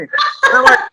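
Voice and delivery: ordinary talk, nothing marked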